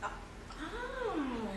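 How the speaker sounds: ordinary talk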